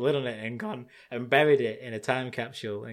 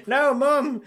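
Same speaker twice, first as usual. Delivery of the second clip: laughing mock plea; 12 semitones higher, 5 dB louder than usual. Calling to his mum, laughing.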